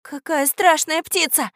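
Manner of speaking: high, slow horrified exclamation; exclaiming. Acted. Katya after the beast has gone, exclaiming. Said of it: "воскликнула Катя".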